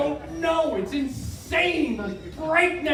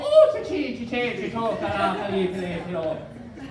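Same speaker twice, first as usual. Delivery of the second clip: Irish ditty; 2 semitones lower than usual. Retold or imitated. Imitating the enchanting Irish girl, in sing-song Irish accent. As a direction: trill nonsense as a lilting Irish tune. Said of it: "everything she said just sounded like a lovely Irish ditty".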